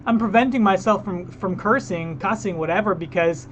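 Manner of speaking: normal talking voice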